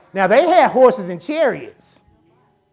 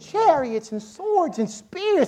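much higher, slow, incredulous servant; panicked disbelief; 11 semitones higher than usual. Retold or imitated. Servant asks Elijah if he's crazy, incredulous. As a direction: ask like he's lost his mind. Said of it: "his servant is like".

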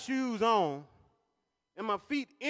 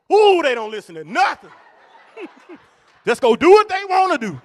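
exasperated; 6 semitones higher, 13 dB louder than usual. Real, not acted. Exasperated about attendants.

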